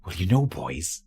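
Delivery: put-on broad rural Welsh accent, rising high and loud; thick Welsh accent. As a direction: quote the teacher in thick Welsh. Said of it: "in a very thick Welsh accent".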